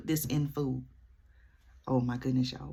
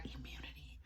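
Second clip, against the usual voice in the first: whispered; 24 dB quieter than usual. Real, not acted. Quoting the bill, dropping voice.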